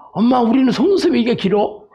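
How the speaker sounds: put-on high-pitched child asking mum; little one pestering mother with a question; baby camel. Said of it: "낙타 새끼가 엄마한테".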